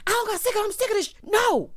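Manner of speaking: high, loud mock tirade; retold furious outburst, loud and high; yelling. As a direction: imitate a man yelling, voice cracking upward. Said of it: "Dennis is yelling at Mama Gina".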